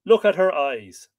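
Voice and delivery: loud inner cry. A narrator's reading. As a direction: cry it out from the soul. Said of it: "my soul cries out".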